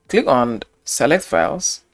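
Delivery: ordinary delivery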